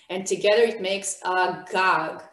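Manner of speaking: ordinary talk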